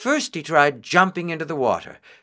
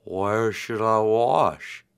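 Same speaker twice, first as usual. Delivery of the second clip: slow turtle voice; 3 semitones lower, much slower than usual. A narrator's reading. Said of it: "he said".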